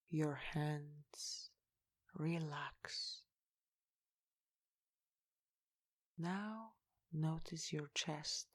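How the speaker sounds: slow, soft meditation guidance with long pauses; guided meditation. Real, not acted. Guide leading listeners to relax each body part, slow meditation voice. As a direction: lead them gently into relaxation, unhurried and hushed.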